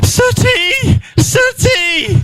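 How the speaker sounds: imitating a neighbour calling her cat, up high. Neighbour finds her dead cat.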